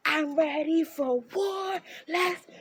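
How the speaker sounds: slow, high war cry; fired up. Retold or imitated. Grabbing her knife to face the roommate, slow battle cry. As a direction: drag out a challenge, ready to fight. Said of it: "I'm like".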